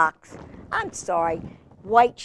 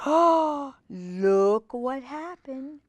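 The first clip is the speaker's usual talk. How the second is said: surprised gasp; 5 semitones higher, much slower than usual. Acted. Gasp at the grown tree.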